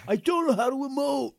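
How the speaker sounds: high, loud put-on Biff voice. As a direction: ham up the bully. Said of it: "That was Biff".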